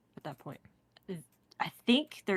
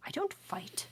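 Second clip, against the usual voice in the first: whisper; 12 semitones higher, 5 dB quieter, slower than usual. Acted. Bard refusing, whispered.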